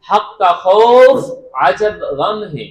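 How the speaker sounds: dictating slowly. Shown as a demonstration. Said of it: "একটি বাক্য লিখাবো তোমাদেরকে".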